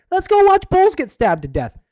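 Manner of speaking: put-on squeaky high and loud kid's cheer; mock excited kid. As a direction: shriek it like a thrilled child.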